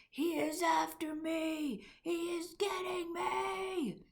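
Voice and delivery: high, slow panicked wail; sleeping giant screaming in terror; terrified giant. A narrator's reading. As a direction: cry out as if being hunted. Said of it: "screamed the flesh lump eater".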